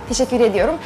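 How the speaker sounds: normal talking voice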